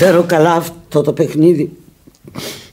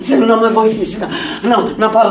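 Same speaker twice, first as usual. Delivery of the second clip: much higher and louder, raging; furious shouting; 11 semitones higher, 5 dB louder than usual. Acted. Sick woman yelling at her friend in fury. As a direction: scream at her to shut up.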